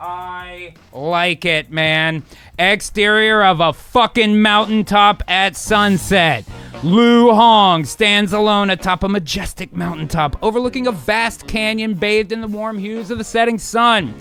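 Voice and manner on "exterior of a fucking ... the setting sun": raised, sweeping storyteller voice; dramatic trailer-style reading; epic scene narration. Acted. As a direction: read it out grandly, building in pitch.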